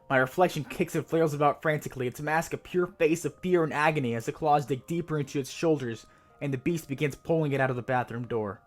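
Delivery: usual speaking voice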